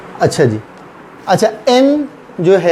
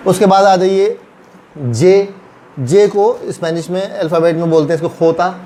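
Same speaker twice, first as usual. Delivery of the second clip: ordinary talk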